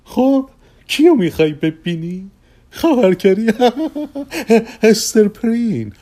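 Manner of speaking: high-pitched showman offering choices with chuckles; lively Persky, teasing. Acted. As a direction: pitch the list up, laughing at your own jokes.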